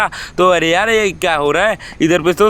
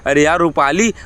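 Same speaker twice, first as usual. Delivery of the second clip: ordinary talk, nothing marked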